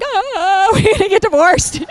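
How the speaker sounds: high, loud mock-panicked husband; laughing mock alarm; husband's shocked quote. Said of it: "he's like".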